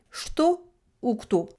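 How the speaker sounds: slow, deliberate shto ou kto; Russian shto and kto. Shown as a demonstration. Teacher sums up the rule with shto ou kto, slowly. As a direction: set shto and kto apart word by word. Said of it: "il est d'abord inanimé ou animé".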